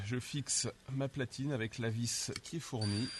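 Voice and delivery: ordinary talk, nothing marked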